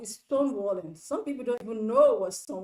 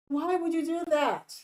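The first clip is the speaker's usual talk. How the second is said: higher, incredulous question; mildly outraged disbelief; exasperated scolding; 5 semitones higher than usual. Acted. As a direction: demand an answer, appalled at the pettiness. Scolding a sulking husband.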